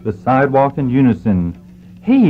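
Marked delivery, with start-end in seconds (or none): 2.0-2.3 high-pitched "hey" called from a window; yell. Acted. Doctor hails men from his office window, yelling. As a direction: pitch jumps way up, a quick yell. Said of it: "yelled the doctor from the window".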